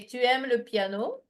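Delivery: "Tu aimes le piano" with a rising lift on piano; question intonation. Shown as a demonstration. Teacher giving an example of asking by intonation, "Tu aimes le piano" rising at the end. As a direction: turn the statement into a question, "piano" going up. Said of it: "So intonation, I would say".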